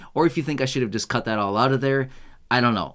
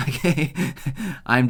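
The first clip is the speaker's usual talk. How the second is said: laughing. Real, not acted. Laughs at pretending to be British.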